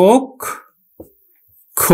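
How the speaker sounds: ওক, long pause, then খ; split syllables. Shown as a demonstration. Teacher breaking the word down for students, split syllables ওক খ. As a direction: break it apart as ok and kho. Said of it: "তাহলে উচ্চারণ হবে কিন্তু".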